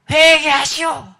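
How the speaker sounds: long, high, loud yell; retold angry shout. Angry youth shouts at heaven, acted yell. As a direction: yell it slowly up at the sky. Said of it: "소리쳤다는 거야".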